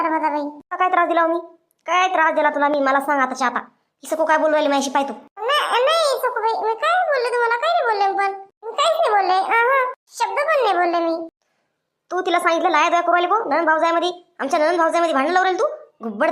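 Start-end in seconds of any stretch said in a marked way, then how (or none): 5.4-11.3 put-on high, pleading young woman's voice; a timid girl swearing she said nothing; innocent maid. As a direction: protest innocence in a high, wide-eyed plea. Maid Rani denies blame.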